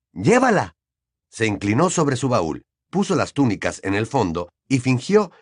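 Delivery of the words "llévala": high, forced eagerness; effusive. A narrator's reading. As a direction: offer it too eagerly in a raised voice. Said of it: "con aquella espantosa voz efusiva". (efusivo, con voz aguda)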